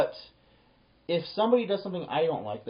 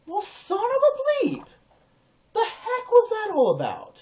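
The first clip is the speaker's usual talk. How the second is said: much higher, slow, outraged inner exclamation; indignant disbelief; 5 dB louder than usual. Retold or imitated. Thinking after a clerk doubted him, outraged and incredulous. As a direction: let the disbelief burst out, drawn out and high.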